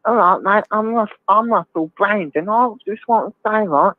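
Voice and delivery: mock Russell Brand, whining about the poor; Russell Brand impression. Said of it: "that's really good impression".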